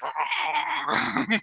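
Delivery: high strangled choking noise; hammed-up possession sound; mock possessed gurgle. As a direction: gag like eyes rolled back. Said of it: "his eyes roll back into his head".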